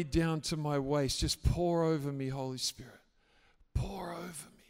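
soft prayer. Shown as a demonstration. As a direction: pray it softly, inviting the Spirit down. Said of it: "why don't you just say".

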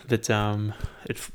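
ordinary delivery

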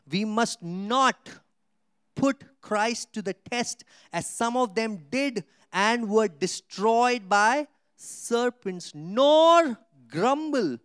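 slow, loud, rising preaching read; preached scripture. Acted. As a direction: thunder the verse out slowly to the church. Reads scripture at full force.

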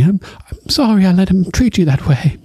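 sheepish, meek sorry; apologetic Sam. Retold or imitated. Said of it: "Sam is apologizing to Gilly".

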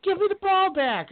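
very high-pitched, pleading imitation; mock movie demand. Movie character wanting the ball back, mock high-pitched demand. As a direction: squeal it like an indignant kid in a film.

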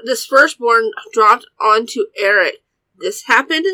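ordinary delivery

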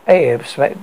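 usual speaking voice

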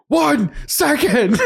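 high, drawn-out mock yell; retold exasperated call. Imitating the co-host halting a rambling guest, exasperated call.

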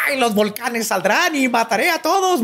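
high, hammy evil villain voice, cackling; mock villain. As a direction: ham up a wannabe edgy villain. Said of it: "tratando de verse malo".

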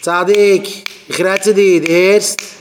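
loud, high-pitched yell; shouting. Acted. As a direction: call out angrily at full voice. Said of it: "wie du schreist".

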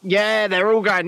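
ordinary delivery

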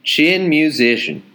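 slow Texas drawl; mild Texas accent. Shown as a demonstration. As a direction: drag it out like a Texan. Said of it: "I'll try and do the accent".

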